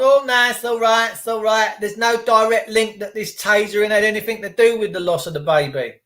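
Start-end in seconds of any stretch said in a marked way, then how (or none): 0.0-1.7 higher, dismissive mock judge; brushing it off like a smug judge. Mocking the judge who dismissed the tasering. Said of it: "But the judge can't".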